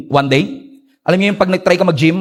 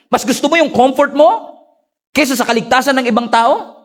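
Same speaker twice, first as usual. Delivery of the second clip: higher, pressing preacher's rebuke; preaching rebuke; 7 semitones higher than usual. Acted. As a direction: drive each question home to the church.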